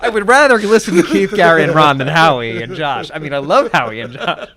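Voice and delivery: loud laughing repeat. Real, not acted. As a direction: says it again, cracking up. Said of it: "now we're getting, like, nobody in my audience".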